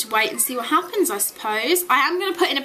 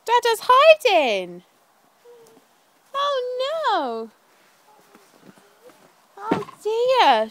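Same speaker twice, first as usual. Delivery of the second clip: playful hide and seek; 12 semitones higher, much slower than usual. Real, not acted. Playful game with toddler.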